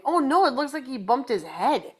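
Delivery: ordinary delivery, nothing marked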